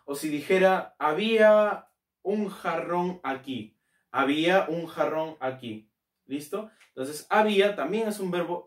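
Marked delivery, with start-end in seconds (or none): 1.0-3.8 half-speed, drawn-out words; slow dictation. Shown as a demonstration. Teacher composing an example sentence for students, slow dictation. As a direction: stretch each word as if writing it.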